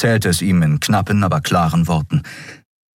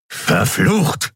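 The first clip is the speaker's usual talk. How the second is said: gruff, higher-pitched exasperation; snarled curse; 8 semitones higher than usual. Acted. Keru loses patience with Endriel, snarling. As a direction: spit the curse out. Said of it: "fauchte Keru".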